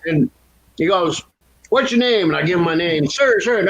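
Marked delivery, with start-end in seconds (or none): 1.7-2.3 mock sergeant. Sergeant questioning the teen. 3.1-3.6 put-on nervous teen. Teen saluting the sergeant.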